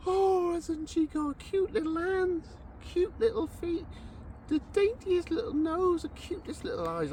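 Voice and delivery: put-on drawn-out, high baby-admiring coo; imitating someone cooing at a baby; mock cooing. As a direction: gush slowly over a newborn, hammed up. Retold brother cooing over baby.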